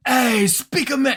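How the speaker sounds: high, drawn-out New York Italian voice; New York Italian accent. Acted. Flipping the phrase to test it, New York Italian accent. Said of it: "New York Italian kind of guy".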